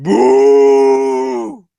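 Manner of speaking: long, loud boo; big drawn-out mock jeer; imitated crowd boo. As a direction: boo like a hostile crowd, held out. Imitates crowd booing Roman.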